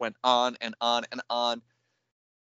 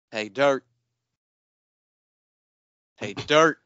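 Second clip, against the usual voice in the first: "Hey, dirt" with DIRT punched and rising; imitating misplaced stress; 4 dB louder, slower than usual. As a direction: mimic a clueless actor hitting "dirt" instead of the first word. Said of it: "You don't put the emphasis on dirt".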